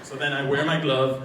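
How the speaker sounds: ordinary delivery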